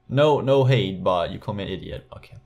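ordinary talk

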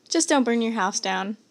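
ordinary talk, nothing marked

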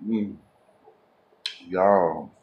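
humming while chewing eggs; talking between bites; mouth full, chewing. Real, not acted. Seen 2: Bite taken at -3s; closed, working mouth through labelled words.

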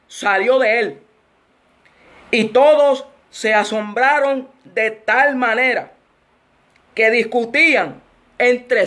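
slow, solemn Bible reading; scripture reading. Real, not acted. Preacher reads the demon-in-the-temple passage, slow scripture reading. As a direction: draw out each phrase and pause between them.

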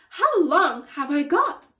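high, loud panicked question; crying out. A narrator's reading. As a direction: cry it out in alarm. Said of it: "cried Missus Twit".

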